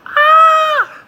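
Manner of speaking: sudden piercing high cry; very high, loud, sustained "ah"; abrupt shout. Acted. Singing girl interrupts her song with an abrupt high shout. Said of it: "The girl abruptly shouts".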